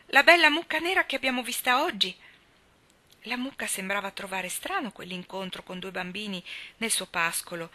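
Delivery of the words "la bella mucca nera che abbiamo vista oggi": relieved, laughing. A narrator's reading. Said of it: "disse ridendo".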